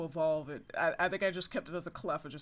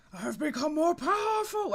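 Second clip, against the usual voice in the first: high, booming villain boast; 11 semitones higher, 5 dB louder than usual. Acted. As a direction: declare your new power proudly. Voices Pokemon boasting.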